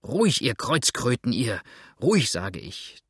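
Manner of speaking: usual speaking voice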